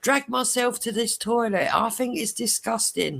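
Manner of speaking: high-pitched tirade to an audience; mock indignant complaint. Acted complaint to the ward.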